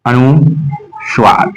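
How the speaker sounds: अनुस्वार very slowly in two parts; slow dictation. Shown as a demonstration. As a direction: break it as अनु, pause, Suar. Teacher breaks term into parts.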